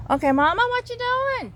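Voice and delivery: high, sing-song pet voice; high cooing voice for a pet hen; baby talk to a hen. Acted. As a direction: coo at the hen like a toddler. Talking to hen.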